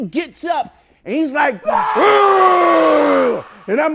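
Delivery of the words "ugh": long, loud imitated yell; enraged roar. Dentist getting up after the kick, roaring. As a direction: let out a sustained high roar of fury.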